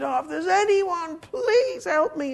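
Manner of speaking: imitated desperate plea; high, drawn-out cry for help. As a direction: wail it as a retold prayer of despair. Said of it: "one night I just cried out".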